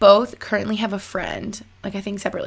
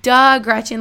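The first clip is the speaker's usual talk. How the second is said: self-scolding; 9 dB louder, slower than usual. Retold or imitated. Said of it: "slapping myself in the head".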